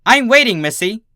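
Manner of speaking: very high, loud stern teacher; impatient demand. A narrator's reading. Teacher cutting off a stammering Dinky, loud and impatient. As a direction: demand an answer now, clipped and sharp.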